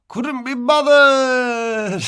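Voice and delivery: high, loud, drawn-out couldn't be bothered; mock exasperation. Host joking to listeners, drawn-out mock sigh. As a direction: ham up being too lazy. Cheeky mock-lazy exclamation.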